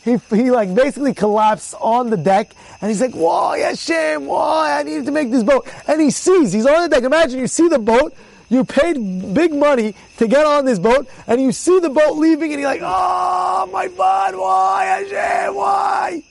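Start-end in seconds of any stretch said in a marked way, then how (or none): 3.1-5.7 high, drawn-out plea to heaven; hammed-up anguished complaint; acted lament. Acts injured man lamenting to God. 12.8-16.2 very high, long-held wailing; stretched, sustained lament, pitched way up; mock despairing wail. Acts passenger watching his boat leave.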